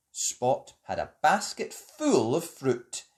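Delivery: usual speaking voice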